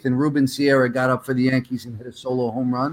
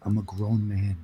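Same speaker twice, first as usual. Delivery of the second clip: lower, stern man-to-man voice; low firm demand; 5 semitones lower than usual. Retold or imitated. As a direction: put your foot down, low and serious. Fan confronting Reggie firmly.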